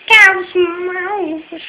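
high, loud Scouse mouse cry; mock Scouse. Youtuber hamming up Scouse catchphrase for viewers, loud and high. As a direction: shout it in a put-on Liverpool accent.

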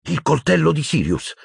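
high, sudden idea; excited, high exclamation. A narrator's reading. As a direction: blurt out the realization. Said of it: "esclamò Harry".

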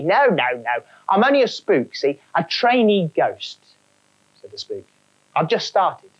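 a cheeky London trainee ghost; cockney spook. Acted. Spook character.

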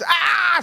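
high-pitched mock scream; imitated scream. Preacher imitating all Egypt screaming at midnight, mock scream. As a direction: imitate the screams without full volume. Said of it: "צרחות".

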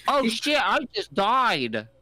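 a hint of a distracted kid playing; imitating the friend lost in his own game; lightly voiced gamer. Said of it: "he'd be like".

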